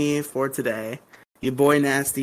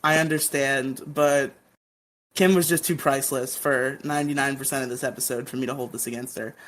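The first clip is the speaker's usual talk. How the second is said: ordinary talk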